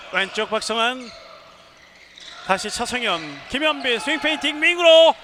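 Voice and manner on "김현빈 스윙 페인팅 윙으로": high, loud rising call; goal call. Real, not acted. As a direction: build to a shout on the shooter. Build-up to a goal.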